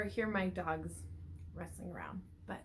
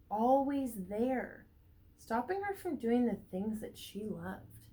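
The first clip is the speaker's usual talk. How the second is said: put-on reading to children. A narrator's reading. Teacher reading a picture book to the class, lively storybook voice. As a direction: read it out to a class of little ones.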